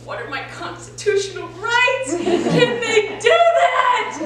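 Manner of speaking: high, loud indignant outburst; outraged teenager. Acted. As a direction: cry it out in rising alarm. Panicked teen voice inside his head.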